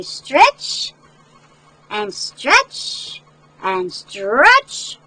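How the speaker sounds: very slow, stretching each word; slow stretched-out storytelling; drawn-out stretch. A narrator's reading. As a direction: pull the words out long like the legs. Stretching legs, drawn-out words.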